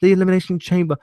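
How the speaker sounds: mock Paul Heyman announcing barbed wire. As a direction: ham up a scheming manager's pitch. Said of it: "Paul Heyman coming in".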